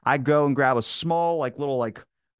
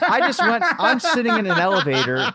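laughing hard, much higher and louder; 9 semitones higher, 5 dB louder than usual. Real, not acted. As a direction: burst out laughing mid-sentence. Laughing while telling the story.

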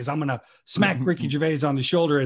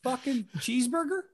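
mock impatient diner; 8 semitones higher, 6 dB quieter than usual. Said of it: "sat in a restaurant and gone".

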